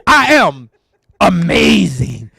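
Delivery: "amazing" stretched out slowly; host retelling the guest's line, slow stress on "amazing"; retold emphasis. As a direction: lean into "amazing" the way she did. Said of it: "she put that little emphasis on it".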